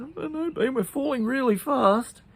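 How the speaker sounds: high, loud worried plea; anxious Pete. Acted. Pete worriedly asks Bean about parachutes, high pitched.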